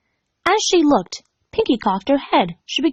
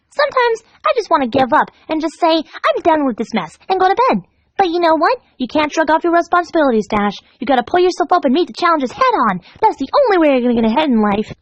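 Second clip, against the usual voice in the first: higher, chirpy pep talk; Pinkie voice; 6 semitones higher than usual. Acted. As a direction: preach cheerfully at her victim. Pinkie lecturing Dash.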